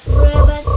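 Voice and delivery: loud croaking frog sound; playful frog noise. Shown as a demonstration. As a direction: croak it out big for the child. Mom demos frog sound to child.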